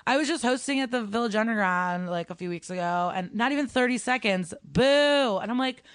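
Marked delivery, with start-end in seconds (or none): 4.6-5.4 mock boo. Imitating the crowd booing.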